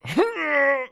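short effortful grunt; acted grunt of effort. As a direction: grunt as if hauling a load. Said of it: "came a grunt behind me".

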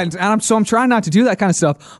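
ordinary talk, nothing marked

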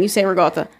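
ricotta said "ruh-GAH-tuh" with a g; New York ricotta. Shown as a demonstration. Explaining, New York Italian ricotta with a g. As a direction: soften the c of ricotta to g. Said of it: "a New York Italian thing".

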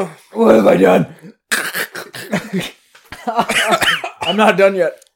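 loud breathless laughter; laughing breathless. Real, not acted. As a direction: laugh through the words. Chugging race, laughing.

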